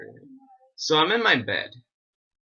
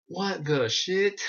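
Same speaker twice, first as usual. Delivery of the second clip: slow, higher-pitched mock disbelief; acted shock; 7 semitones higher, much slower than usual. Retelling first look inside the TARDIS, acted shock. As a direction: draw it out high in playful astonishment.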